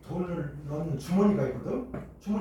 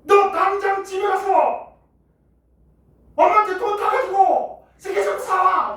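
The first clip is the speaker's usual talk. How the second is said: slow, high, loud shouted order; fed-up teacher yelling an order; furious teacher shouting; 10 dB louder than usual. Retold or imitated. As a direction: send the kid home at full volume. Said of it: "선생님이 빡친 거야".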